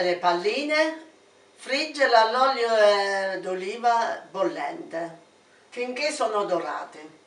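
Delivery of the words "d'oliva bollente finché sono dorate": normal talking voice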